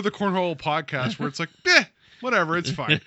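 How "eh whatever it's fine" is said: dismissive. Retold or imitated. Mocking their own careless podcast.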